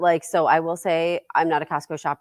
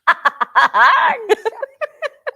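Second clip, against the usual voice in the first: loud high burst of laughter; high laughing fit; 11 dB louder than usual. Real, not acted. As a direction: crack up hard. Laughing at the mom impression.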